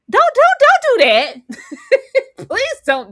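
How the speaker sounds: high, loud, laughing plea; mock pleading. Fan begging the show not to, loud and high.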